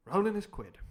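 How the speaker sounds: ordinary delivery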